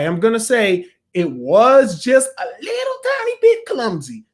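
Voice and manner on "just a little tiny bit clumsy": small high-pitched squeak; squeaky understatement. Real, not acted. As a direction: squeeze it tiny and high. Hedging verdict in a squeaked voice.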